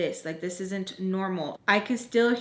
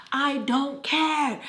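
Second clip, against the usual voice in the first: drawn-out, higher-pitched mock yell; retold scream; 4 semitones higher, much slower than usual. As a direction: stretch it out in exasperated imitation of screaming. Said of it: "I'm, like, screaming at Skillet".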